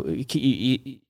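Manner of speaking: ordinary talk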